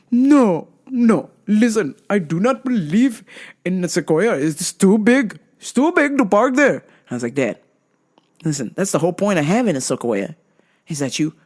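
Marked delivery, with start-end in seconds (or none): 0.1-6.8 imitating her dad, raised and insistent; stubborn dad pleading, a bit higher. Dad refusing, voiced by daughter.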